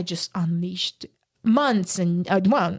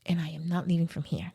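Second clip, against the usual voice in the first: whispered resolve; 4 semitones lower, 8 dB quieter than usual. Retold or imitated. Her silent resolve on arrival.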